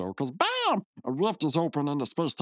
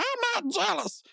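squeaky denial; slower than usual. Retold or imitated. Rigby denying jealousy.

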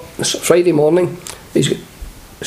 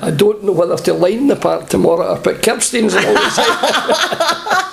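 higher and slow, laughter breaking into the words; laughing through the punchline; 6 semitones higher than usual. Retold or imitated. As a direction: deliver the groundsman's joke while chuckling. Groundsman's joke told laughing.

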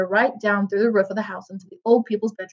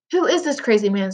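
usual speaking voice

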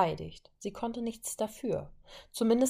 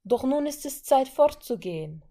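urgent plea; 7 semitones higher, 7 dB louder than usual. Acted. Wanda pleads.

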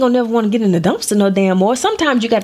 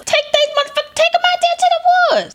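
heated rant. Real, not acted. Ranting advice.

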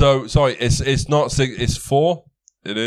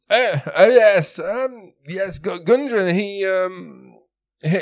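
high-pitched put-on accent; the old soldier recalling Gundren's brothers, pitched up; Sildar's accent; 7 semitones higher than usual. Sildar telling the party about Gundren's brothers, high accent. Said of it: "my bloody accent's going".

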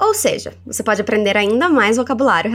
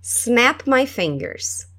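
slow teaching read; slow model; much slower than usual. Shown as a demonstration. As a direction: stretch each word for learners. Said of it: "Como é estalar os dedos".

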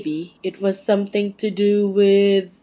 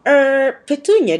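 hesitant, higher nervous opener; nervous hesitation; 6 semitones higher, 5 dB louder than usual. Acted. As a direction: clear your throat and falter. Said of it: "cleared his throat nervously".

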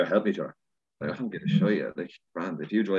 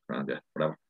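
dismissive shrug; 4 semitones lower, 5 dB quieter, faster than usual. Retold or imitated. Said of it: "I'm like".

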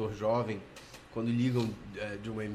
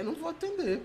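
retold refusal; 4 dB quieter than usual.

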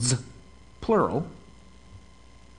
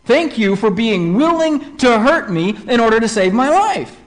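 lightly voiced thankful kid, a bit louder; grateful child; 5 semitones higher, 5 dB louder than usual. Saved kid thanks the parent, in mock-grateful, raised voice. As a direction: play up the kid's thanks, pitched higher. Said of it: "he's gonna be grateful".

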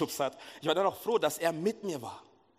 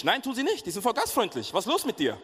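mock carefree; 8 semitones higher, 3 dB louder than usual. Brother-in-law dismisses his fear.

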